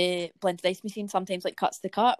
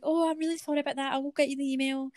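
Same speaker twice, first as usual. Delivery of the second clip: sweet apology; 6 semitones higher than usual. Retold or imitated. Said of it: "Sogand was like".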